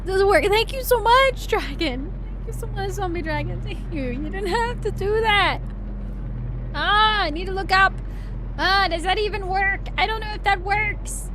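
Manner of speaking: holding back crying while thanking; teary. Real, not acted. Streamer moved by gifted subs, trying not to cry. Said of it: "I'm gonna cry".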